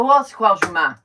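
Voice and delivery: loud, high retold retort; mock exasperation. Daughter answers her confused mother, loudly exasperated. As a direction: imitate yourself exasperated with your mother.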